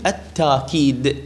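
"التاكيد" with a long aa, no glottal stop; hamza turned alif. Shown as a demonstration. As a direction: say "التاكيد" slowly as at-taakid. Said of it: "تقلب الهمزة إلى ألف".

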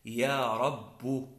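"رب" drawn out as rabbu; slow demo of "رب" with u after doubled b; damma on last letter. Shown as a demonstration. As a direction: slowly end "رب" on a u. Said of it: "بضم الحرف الأخير".

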